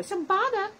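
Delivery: mock rap hook. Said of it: "great Beastie Boy".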